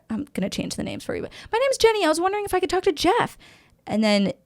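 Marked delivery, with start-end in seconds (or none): 1.5-3.4 high, fast, bubbly cold-call greeting; a salesperson cheerfully opening a cold call, high and quick; chirpy sales voice. Retold or imitated. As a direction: sell it brightly, up in the head voice. Cold-calling a broker as salesperson.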